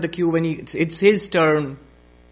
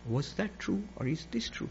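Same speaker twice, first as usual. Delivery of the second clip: very soft, far-away murmur; quietly bewildered emperor; dazed questioning; 12 dB quieter than usual. Acted. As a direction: ask it almost to yourself, hushed. Dazed emperor repeats his question.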